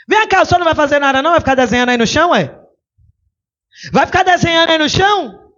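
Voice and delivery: quick mocking challenge from the crowd; pressing accuser. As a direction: needle him like an impatient crowd.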